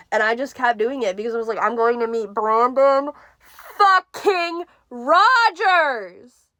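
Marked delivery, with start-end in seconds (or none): 1.6-6.5 high, loud, very slow drawn-out squeal; excited drawn-out. Retold or imitated. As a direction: stretch out every word in giddy excitement. Retelling her excited texts.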